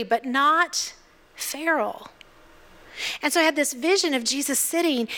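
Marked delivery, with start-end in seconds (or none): 1.3-2.1 hushed punchline. Acted. Callback punchline to feral whisper.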